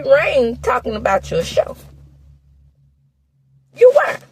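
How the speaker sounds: high-pitched, exasperated telling-off; indignant scolding. Real, not acted. Vlogger tells off Carlos over Melody, indignant scolding. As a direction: call him out, voice riding up high.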